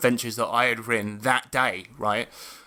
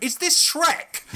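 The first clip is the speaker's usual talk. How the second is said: much higher, slow, faux-innocent; mock innocent question; slower than usual. Uncle teasing his niece during Encanto with a high slow innocent question. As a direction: ask it sweetly to wind her up. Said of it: "Like that".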